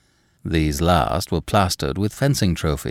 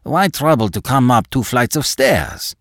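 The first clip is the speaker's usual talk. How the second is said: higher, mildly exasperated question; crying out impatiently; 4 semitones higher, 3 dB louder than usual. A narrator's reading. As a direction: protest with a touch of impatience. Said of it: "he cried".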